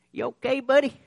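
put-on a light high voice for a gentle old man; kind old man. Old man checking on a crying boy at the door, kindly. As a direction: ask it kindly, like a stranger checking on a kid.

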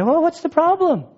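high-pitched mock gardener. Gardener asking Mary at the tomb, high mock innocence. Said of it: "She sees the gardener saying".

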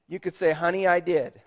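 sermon tribute read with lifted voice. Acted. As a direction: proclaim it to every mother in the room. Reads Mother's Day tribute.

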